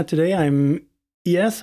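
usual speaking voice

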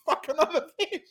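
high comic quote, laughing through; mock Peacemaker line. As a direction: relish the punchline.

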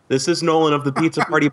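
normal talking voice

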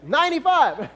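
high, loud brag; cocky boast. Retold or imitated. Wife bragging about driving ninety-five. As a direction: toss it off proudly. Imitating wife's speed boast.

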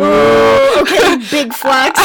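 loud, high mocking ooh; loud mock-impressed reaction; teasing ooh. Real, not acted. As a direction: call out the brag loudly.

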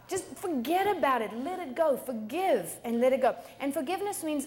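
ordinary talk

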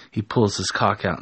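ordinary delivery, nothing marked

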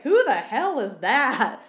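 loud, slow, incredulous demand; mock-outraged dad, loud and drawn out; baffled dad. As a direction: imitate a baffled dad, stretching each word. Acts out dad's baffled question.

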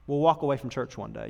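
usual speaking voice

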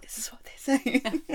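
sneaky whisper with a laugh in it; whispered translation. Retold or imitated. Guide secretly translating paid tours for her, whispered. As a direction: whisper it covertly, half laughing.

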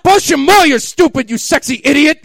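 very high, loud hammed-up yell; mock yell. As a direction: shriek it in mock passion. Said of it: "she yelled".

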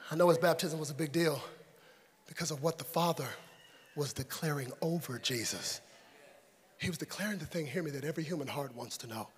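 very soft, low, solemn sermon voice; hushed preaching. Real, not acted. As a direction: bring the sermon right down, low and intimate.

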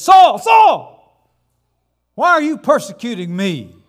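Saul, Saul shouted high and loud; booming heavenly voice. Acted. Voice from heaven calling to Saul, shouting. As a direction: thunder it down from heaven.

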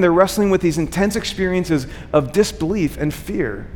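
ordinary talk

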